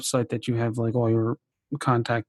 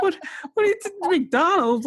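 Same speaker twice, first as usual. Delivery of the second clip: high, incredulous disbelief; surprised. Retold or imitated. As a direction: squeak it out in shock. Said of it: "I'm like".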